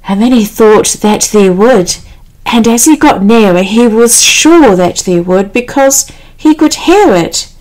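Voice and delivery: usual speaking voice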